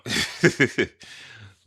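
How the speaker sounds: high, breathy laugh; amused laugh at the friends who vanished; burst of laughter. Real, not acted. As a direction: laugh out at the memory. Laughing at his own story.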